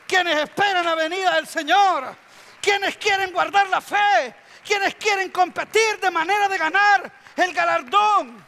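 loud, high, stretched rallying cries; full-force preaching. Real, not acted. Preacher rallying champions in the congregation, full-force shouting. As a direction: rouse the congregation with shouted calls.

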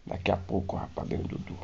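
ordinary talk

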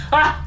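short high cry; startled yelp. Real, not acted. Player surprised by the new level, startled yelp.

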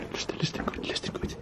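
frightened hushed whisper; nervous night vlog whisper. Real, not acted. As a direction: whisper urgently in the dark. Scared whisper in haunted farmhouse.

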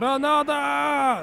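drawn-out mock battle cry. Player showing off a grenade throw, drawn-out holler. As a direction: stretch it out like a grenade war cry.

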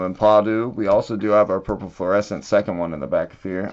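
normal talking voice

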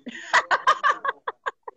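high-pitched laugh. Real, not acted. Mocking the other person. (tiếng cười cao giọng)

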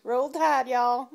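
loud Alabama twang; playful Alabama cheer, loud; mock backwoods Alabama. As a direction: call it out as a backwoods Alabama fan. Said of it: "You look so backwoods Alabama".

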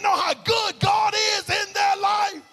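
high, loud preacher's shout over music; preaching at full force. Acted. Pastor shouting the climax to his church, full force. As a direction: ring it out to the congregation.

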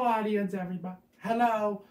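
normal talking voice

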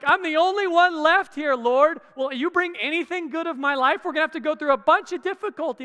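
mock anxious lament, fast at the end; troubled complaint. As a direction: voice the worried believer crying out to the Lord. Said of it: "they're troubled because it's like".

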